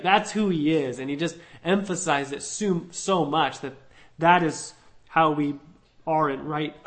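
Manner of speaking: ordinary delivery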